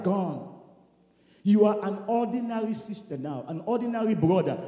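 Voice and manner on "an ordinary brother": ordinary delivery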